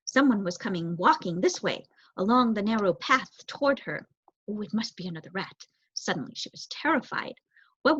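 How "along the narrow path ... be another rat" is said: ordinary delivery, nothing marked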